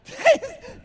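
hearty burst of laughter; loud high laugh. Real, not acted. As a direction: crack up at the video. Laughs at the clip.

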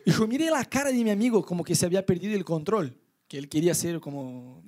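usual speaking voice

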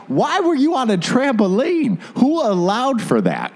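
high-pitched, disbelieving questions; retold incredulous outrage. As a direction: demand answers in exaggerated disbelief. Teller's incredulous reaction in a story.

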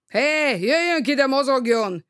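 mild yell through a door. Acted. Burns yelling at Hacker through the bathroom door, mild yell. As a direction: call him out, half-shouting. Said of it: "mit ordít maga?".

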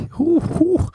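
very high hooting; mock hooting. Comic cheering seventeen months of quarantine, very high hoots. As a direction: whoop like a mocking owl. Mocking someone's ugly date.

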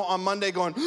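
ordinary delivery

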